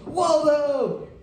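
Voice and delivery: high, loud burst of Waldo; sudden Waldo yell. Retold or imitated. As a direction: jolt out of the drone into a shout. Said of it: "like a bidet shot up his ass".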